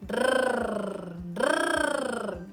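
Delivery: "drrrr" with a long trilled rolled r. Shown as a demonstration. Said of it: "roll your R".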